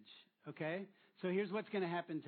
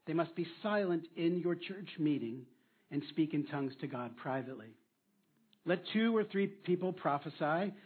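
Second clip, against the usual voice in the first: louder, solemn Bible reading; scripture reading; 6 dB louder than usual. Acted. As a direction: proclaim the verses to the congregation. Reads scripture aloud loudly.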